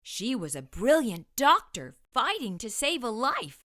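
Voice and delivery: ordinary delivery, nothing marked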